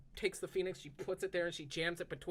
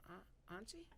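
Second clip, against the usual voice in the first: timid small voice; 6 semitones higher, 17 dB quieter than usual. Acted. Newborn construct speaks timidly.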